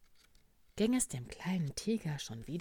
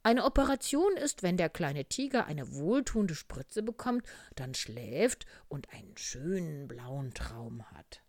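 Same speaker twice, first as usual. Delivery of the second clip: doctor frog explaining; 3 semitones higher, 7 dB louder than usual. Acted. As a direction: explain it like a bedtime marvel, in short steps. (lebhaft erklärende Arztstimme)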